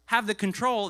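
ordinary delivery

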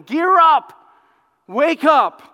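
loud, high rallying cry; urgent pulpit command; rousing shout. Acted. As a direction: shout it like a call to arms. Preacher rousing the church.

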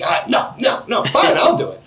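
put-on grudgingly giving in like a sulky five-year-old; cranky kid. Dad imitating his cranky son giving in, rising whiny kid voice. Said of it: "he's being real cranky".

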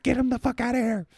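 frantic high shout. Retold or imitated. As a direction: yell to drag him away. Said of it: "Brandon's just like".

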